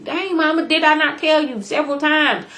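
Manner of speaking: a son blaming mama, half-acted; mad son. Son scolds his mother over the child, mock angry. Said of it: "he's all mad and upset".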